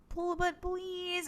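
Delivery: high, drawn-out begging; slow mock-childish plea; pleading little kid. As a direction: imitate a small boy begging, stretching the please. Retold child begging his big brother.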